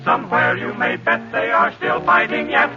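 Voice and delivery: usual speaking voice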